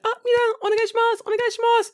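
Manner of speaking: very high, quick mimicked classroom plea; really high teacher voice. Teacher politely addressing her class, imitated really high voice. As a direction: put on a squeaky-polite teacher. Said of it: "He's like, really high".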